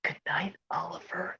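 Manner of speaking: hushed, whispered good night; bedtime whisper. Acted. Girl saying good night to her kitten at bedtime, whispered. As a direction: whisper it softly, drawing out the name. Acted bedtime scene from the movie.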